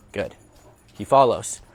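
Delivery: normal talking voice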